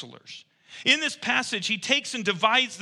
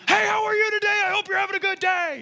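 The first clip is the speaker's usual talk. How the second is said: much higher and louder, like a text in all caps; mock all-caps text; 4 dB louder than usual. Pastor voicing a caps-lock text, high and loud. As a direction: shout a friendly greeting like caps lock is on. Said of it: "everybody just thinks you're screaming at 'em".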